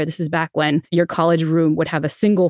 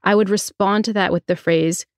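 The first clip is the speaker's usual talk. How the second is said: ordinary talk, nothing marked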